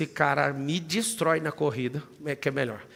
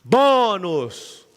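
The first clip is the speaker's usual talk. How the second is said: loud, higher exclaim of bonus; excited cry; 4 semitones higher, 7 dB louder than usual. Acted. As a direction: shout it like a prize. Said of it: "Isso aqui chama bônus". (grito animado e agudo)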